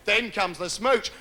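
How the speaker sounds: ordinary talk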